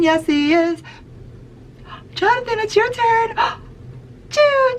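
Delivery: high, gasping choo choo; excited train calls. Shown as a demonstration. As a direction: squeal it with delight for each child. Train game with kids.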